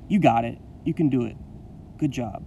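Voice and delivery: ordinary delivery, nothing marked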